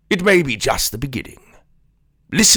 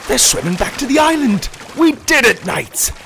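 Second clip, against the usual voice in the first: excited cheering; 7 semitones higher than usual. Acted. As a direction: cheer on the dragon. Cheering the dragon attack.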